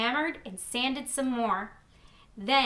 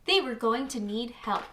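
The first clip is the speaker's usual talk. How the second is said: plain reading voice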